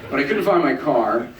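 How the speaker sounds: normal talking voice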